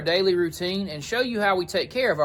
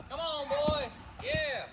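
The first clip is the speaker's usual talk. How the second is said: coaxing horses; 10 semitones higher, 10 dB quieter, slower than usual. Real, not acted. Calling the horses in.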